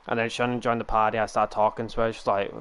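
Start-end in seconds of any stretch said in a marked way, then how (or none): none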